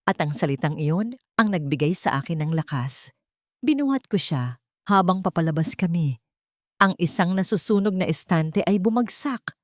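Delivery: ordinary delivery, nothing marked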